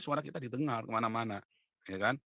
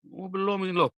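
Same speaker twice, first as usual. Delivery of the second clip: imitating a complainer; 7 semitones higher, 4 dB louder than usual. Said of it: "YouTubers ngadu ke saya".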